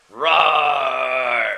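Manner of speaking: long, loud roar; angry roar. Acted. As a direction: let out a big angry roar. Guy roars, very angry.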